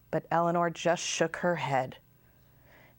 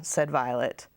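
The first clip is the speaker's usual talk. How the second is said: ordinary delivery, nothing marked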